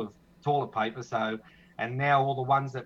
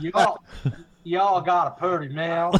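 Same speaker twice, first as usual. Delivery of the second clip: mock Southern drawl; 5 semitones higher, 6 dB louder, slower than usual. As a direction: fake American Southern accent, loud and pitched up. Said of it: "cut the thick Australian accent".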